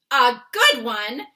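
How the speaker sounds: loud, slow incredulous echo; as if the question is absurd; loud indignant giant's echo; indignant cry. A narrator's reading. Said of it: "he cried".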